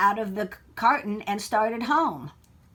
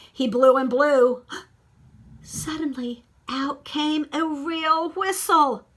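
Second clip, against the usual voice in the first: louder build into a gasp and slow reveal; building excitement; 5 semitones higher, 6 dB louder, slower than usual. A narrator's reading. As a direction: hold the breath, then reveal it slowly. Climax of story, gasp then reveal.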